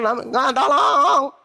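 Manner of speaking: drawn-out, high whining "tolong"; slow, high mock begging; mock plea for help. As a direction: whimper like a caught thief begging.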